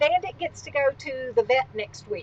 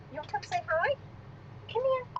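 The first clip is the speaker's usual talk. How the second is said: higher, softer coaxing pet voice; soft, high puppy talk; 8 semitones higher, 10 dB quieter, faster than usual. Real, not acted. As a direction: sweet-talk the dog over to you. Coaxing her puppy to come over.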